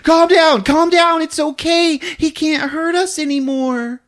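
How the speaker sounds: high, urgent attempt to calm someone; hammed-up frantic reassurance; Jennifer. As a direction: reassure in a high, strained pitch. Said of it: "And Jennifer's like".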